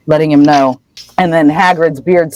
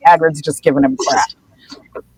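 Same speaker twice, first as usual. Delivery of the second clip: ordinary delivery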